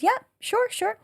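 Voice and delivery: light, breezy sure sure; breezy technician. Retold or imitated. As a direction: toss off an easy yes. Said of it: "She goes".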